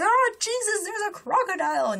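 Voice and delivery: high-pitched imitated panic; mock freak-out. What the bitten man didn't say, mock panicked. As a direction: act out an over-the-top alarm, high up. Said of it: "he's not freaking out or anything".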